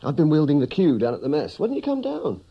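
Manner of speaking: high, loud, eager questioning; animated chiding. Acted. Friend asking why he stayed away from the mess, animated. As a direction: scold a friend cheerfully, pitch climbing high.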